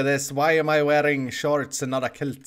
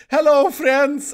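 high, slow, overly cheerful greeting; a hammed-up tourist saying hello to his friends; mock clueless tourist; 9 semitones higher, 6 dB louder, slower than usual. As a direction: greet them like an awkward foreigner, drawn out. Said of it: "I speak like this".

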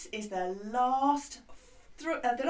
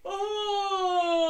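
loud open-throated oh; big open oh like at the doctor's; 8 semitones higher, 4 dB louder than usual. Shown as a demonstration. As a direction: open your throat and call out oh. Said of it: "Gonna open our throat".

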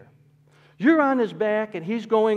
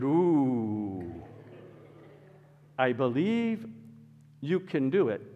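hesitant manager; 4 semitones lower, 3 dB quieter, slower than usual. Retold or imitated. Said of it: "And he said".

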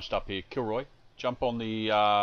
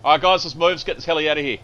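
loud rallying call, pitched up; shouting to the squad; 6 semitones higher, 11 dB louder than usual. Real, not acted. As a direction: yell it to get everyone moving.

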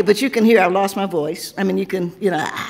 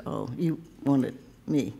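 lower, much softer, slow dawning "oh"; sheepish realization; 6 semitones lower, 9 dB quieter, slower than usual. Acted. Poet realizing Kwame wanted her to speak, soft and sheepish. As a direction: let it sink in quietly, a little embarrassed.

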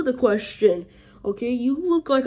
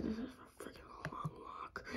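tired whisper; 24 dB quieter than usual. Acted. Complaining about long walk.

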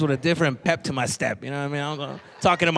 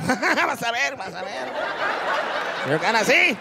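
evil laughing threat; 5 semitones higher than usual. Retold or imitated. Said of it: "That evil laugh".

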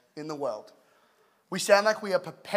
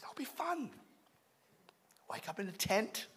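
lightly pitched-up, quiet coaxing; wife's pitch; 2 semitones higher, 13 dB quieter than usual. Retold or imitated. Wife talking husband into camping, imitated coaxing.

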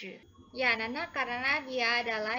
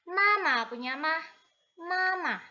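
mama higher, drawn out slowly; 4 semitones higher, 5 dB louder than usual. Shown as a demonstration. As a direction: sing mama out for the kids. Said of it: "Mama artinya ibu". (mama diucapkan tinggi dan pelan)

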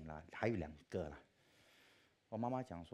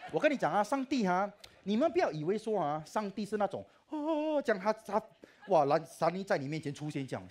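much higher, loud animated rant; loud high laughing tirade; excited rant; 12 semitones higher, 11 dB louder than usual. Real, not acted. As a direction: get worked up mocking a grand God. Mocking idea of grand God.

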